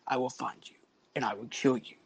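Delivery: low, quiet, far-off threat; Liam Neeson menace. Retold or imitated. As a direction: growl it low and deadly. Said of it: "when he says".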